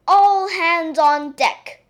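loud, slow commanding call; crying out. Acted. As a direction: call the crew from outside. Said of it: "cried a voice outside".

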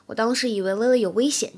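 ordinary talk, nothing marked

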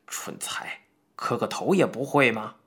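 hissed under the breath through gritted teeth; whispered insult, then a muted rebuke; low scolding. A narrator's reading. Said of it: "师傅咬牙低声骂道".

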